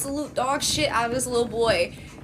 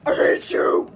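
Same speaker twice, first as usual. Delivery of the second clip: retold angry yell, rough and louder; furious outburst; 6 semitones higher, 4 dB louder than usual. Child stands over fallen girl, furious. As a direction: hurl it down at an enemy.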